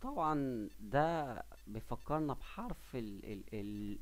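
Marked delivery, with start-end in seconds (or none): none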